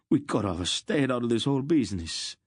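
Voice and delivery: high, loud despairing wail; a merchant bewailing his fate. A narrator's reading. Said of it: "the first merchant wailed".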